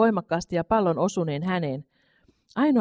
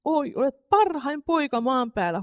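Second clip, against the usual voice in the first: higher, slow, tender exclamation; loving cry; 8 semitones higher than usual. A narrator's reading. Mother embraces her son, tender and moved. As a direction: cry out with love for your son. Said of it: "huusi äiti".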